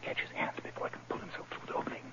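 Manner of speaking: tense whispered command; urgent whisper. Acted. Holmes whispering orders as the thief climbs through. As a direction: hiss the order without a sound.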